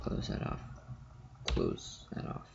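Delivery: whispered under the breath; muttering to self. Real, not acted. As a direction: mutter it quietly while typing. Muttering to self while typing.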